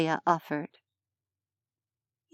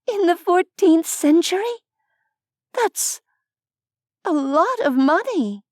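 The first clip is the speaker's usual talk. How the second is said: amazed disbelief; 10 semitones higher than usual. A narrator's reading. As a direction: marvel at the fortune. Nevaeh amazed at the sum.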